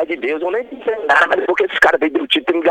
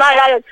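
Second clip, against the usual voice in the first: mock "ai, ai, ai" yelp, higher and louder; imitating pained cries; 6 semitones higher, 7 dB louder than usual. Shooter reenacting the victim's screams for the host, mock yells. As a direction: mimic the wounded man shouting. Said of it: "eu gritando".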